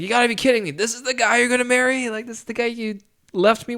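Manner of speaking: high, incredulous outburst; can't believe who she's marrying; retold jilted husband's disbelief, pitched high; outraged disbelief. Ex-husband confronts principal's new fiancé.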